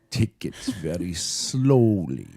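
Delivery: put-on slow German accent. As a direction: drag it out like Werner Herzog. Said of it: "like, German accent".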